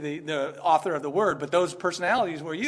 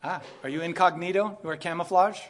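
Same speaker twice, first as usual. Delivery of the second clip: ordinary talk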